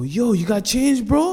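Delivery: high, drawn-out begging call from far off; mock panhandler call. Panhandler calling from down the street, high and slow. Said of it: "we hear a guy go".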